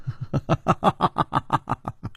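light, rippling unvoiced giggle; quick string of tittering laughs; silvery giggle. A narrator's reading. Princess cuts off the hero with a silvery giggle. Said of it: "那银铃般的笑声".

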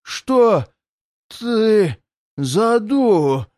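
demon voice. Acted. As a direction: press him like a suspicious monster. The demon asks the narrator.

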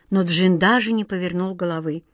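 usual speaking voice

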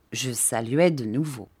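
ordinary delivery, nothing marked

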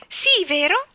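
high, loud, delighted trill. A narrator's reading. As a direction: trill it out, pleased as can be. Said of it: "trillò Nelly Rubina". (trillo acuto e compiaciuto)

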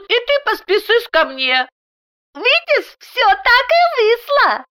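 put-on very high lisping child voice; squealing little girl. A narrator's reading. Little daughter greets her papa from the wall, squealing. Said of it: "Взвизгнула".

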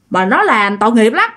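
plain reading voice

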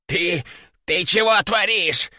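gasping, wheezing parrot voice; breathless wheeze. A narrator's reading. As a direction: wheeze it out, winded and panting. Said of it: "задыхаясь, прохрипел он".

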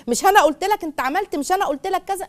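high, reproachful told-you-so; mock a wife scolding her husband; nagging wife. Husband imitating his wife's I-told-you-so, high and reproachful. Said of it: "أصلها بتقول لي".

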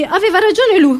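usual speaking voice

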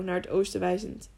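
usual speaking voice

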